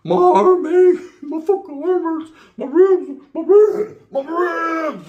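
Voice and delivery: high, loud fake wailing in pain; playing the victim. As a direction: moan and cry hammed-up for sympathy. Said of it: "I'm gonna play the victim role".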